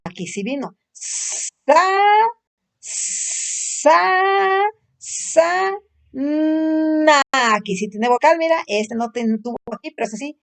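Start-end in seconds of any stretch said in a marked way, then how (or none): 0.9-7.2 "Sa" with long hissed s, "na" with long hummed n; slow phonics syllables; sounding out syllables. Shown as a demonstration. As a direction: stretch sa and na, higher pitched. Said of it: "¿Cómo dijimos que la hace?".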